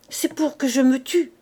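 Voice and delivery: high, loud panicked pleading; terrified stammer. Acted. Coupeau terrified by the water, panicked cry. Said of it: "Et il bégayait, les dents claquant d'épouvante".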